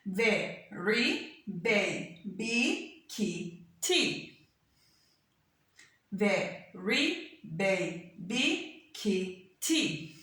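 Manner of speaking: "Ve, ri, be, bi, ki, ti" as isolated beats; each word split in two, a gap after every syllable. Shown as a demonstration. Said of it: "that means that you have two syllables".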